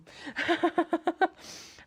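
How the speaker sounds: long, loud, high giggling run; high laughter. Real, not acted. Vlogger delighted by chance meeting, high laughter. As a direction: laugh hard, high and quick.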